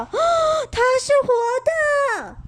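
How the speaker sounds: startled mother shrieks in fear; frightened shriek. Acted. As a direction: scream as the doll comes alive. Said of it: "张着嘴巴叫".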